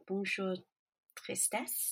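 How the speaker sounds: self-mocking French accent, fading to a hush; terrible French accent. Shown as a demonstration. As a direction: say the French title shyly, in a clumsy French accent. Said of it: "I'm going to show my terrible French accent".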